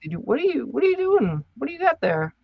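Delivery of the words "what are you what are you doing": ordinary talk, nothing marked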